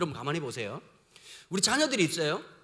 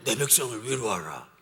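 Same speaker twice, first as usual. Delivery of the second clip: tender God voice; 12 semitones lower than usual. Acted. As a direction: speak as a gentle God consoling. God suddenly comforting people.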